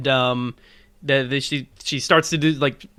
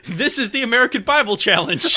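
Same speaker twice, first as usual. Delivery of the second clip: game show host; 6 semitones higher than usual. Pretend.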